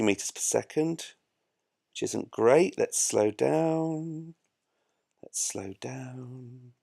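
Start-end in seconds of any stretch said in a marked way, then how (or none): 2.8-6.7 very slow, stretched final word; slow, lingering words, fading softer on the repeat; drawn-out slowing down. Pretend. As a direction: drag it out like something winding down. Mimics slowing down while braking.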